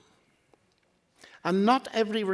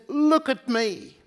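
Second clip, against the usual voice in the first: yelled; 5 semitones higher, 4 dB louder, slower than usual. Retold or imitated. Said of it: "he yelled at us".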